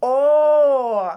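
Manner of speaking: loud, long, held "О"; sound demonstration. Shown as a demonstration. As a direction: belt out a sustained "oh". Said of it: "звук". (гучне протяжне «О!»)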